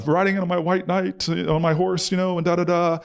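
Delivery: high, grandly self-satisfied hero voice; arrogant savior. Retold or imitated. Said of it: "I go with this arrogance".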